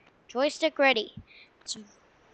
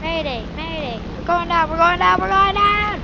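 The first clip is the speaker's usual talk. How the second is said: mock mayday call; 6 semitones higher, 8 dB louder than usual. Mock distress call while crashing.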